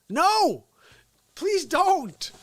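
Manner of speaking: high, loud mock pleading; high mock plea. Mocking a pleading voice high and loud. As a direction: squeal a pleading no.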